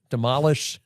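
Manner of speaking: put-on playful French accent, stress on the wrong syllable of demolish; mock French accent. Co-host teasing in a light French accent. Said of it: "you're adopting my French accent".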